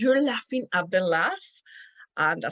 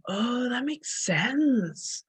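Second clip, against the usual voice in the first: higher, very slow, stretched-out realisation; dawning understanding; 4 semitones higher, much slower than usual. Retold or imitated. Speaker grasping the English O at last, drawn-out realisation. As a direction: draw it out as it clicks. Acts out her realisation about vowels.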